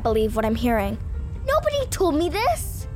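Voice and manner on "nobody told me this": high, indignant cry; outraged. Acted. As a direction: wail it like a wronged teen. Penny outraged about no internet.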